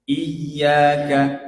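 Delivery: loud 'Iyyaka' as 'iy-ya-ka'; pressed doubled y. Shown as a demonstration. Teacher modelling for repetition, pressed y. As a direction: lean firmly on the y of 'Iyyaka'. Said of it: "Coba ulangi ya".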